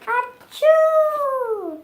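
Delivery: loud, high fake sneeze; a big theatrical achoo. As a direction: ham up a huge sneeze. Bear sneezes in the story.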